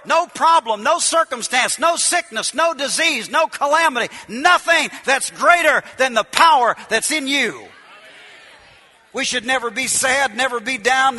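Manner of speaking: loud, ringing preacher's cadence; preaching. Acted. Preacher rousing the congregation, full-force preaching.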